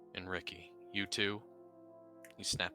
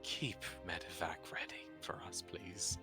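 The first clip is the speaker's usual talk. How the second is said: whispering; 7 dB quieter than usual. Acted. Quiet in-character plea.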